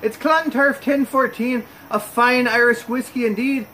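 a deliberately bad, playful Irish accent; horrible Irish accent. Shown as a demonstration. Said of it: "My horrible, uh, impression there".

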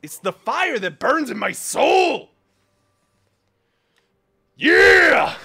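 high, loud pumped yell; fired-up shout. Real, not acted. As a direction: roar it out like fuel in the veins. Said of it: "it's fueling me".

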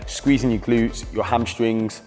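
usual speaking voice

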